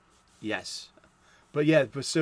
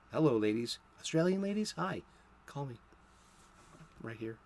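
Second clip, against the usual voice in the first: mock come-on, softer and trailing away; half-joking flirt, voice dropping very quiet; playful flirting; 7 dB quieter, faster than usual. Podcaster jokingly flirting with Australian ladies, playful mock-suave. Jokey flirting aside to listeners.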